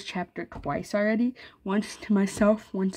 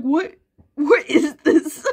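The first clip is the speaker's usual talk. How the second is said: mock confusion; 7 semitones higher, 7 dB louder, slower than usual. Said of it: "They're like".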